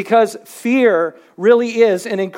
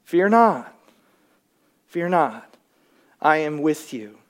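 low, soft reassurance, settling quieter; gentle invitation; 7 semitones lower, 5 dB quieter than usual. Shown as a demonstration. God reassuring the fearful that he is with them, gentle invitation. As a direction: invite gently, with pauses between phrases. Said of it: "much more of an invitation".

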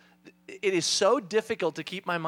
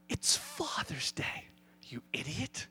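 God chiding; 5 semitones lower, 9 dB quieter, slower than usual. Retold or imitated. God reminding the harried dad, chiding under the breath. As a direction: stretch it out softly, then drop low on the insult. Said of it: "He goes".

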